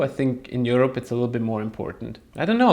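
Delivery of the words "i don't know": sing-song high rising shrug. Real, not acted. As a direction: toss it up high, playfully. Playful shrug ending opinion.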